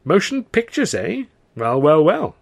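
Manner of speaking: high-pitched, wondering musing; flabbergasted. A narrator's reading. Comedian mulling a motion picture offer, flabbergasted. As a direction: turn the news over in amazement. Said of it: "Charlie was flabbergasted".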